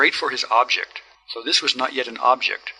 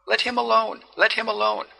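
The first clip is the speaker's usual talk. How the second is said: higher, pleading near-tearful cry; almost weeping; 10 semitones higher than usual. A narrator's reading. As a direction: beg him, close to tears. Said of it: "She was almost weeping".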